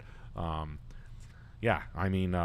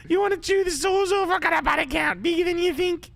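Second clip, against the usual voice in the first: falsetto nurse; 7 dB louder than usual. Retold or imitated. Mocking a crude American nurse hitting on the Aussie, high falsetto. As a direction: drag it out in a high lewd woman's voice.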